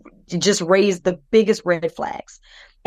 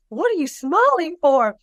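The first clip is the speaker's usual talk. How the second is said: high-pitched, puzzled mock retort; retold bafflement; 8 semitones higher than usual. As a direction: re-enact asking in disbelief, pitch jumping up. Retorts to smiling sister-in-law.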